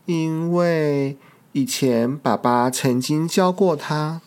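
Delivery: plain reading voice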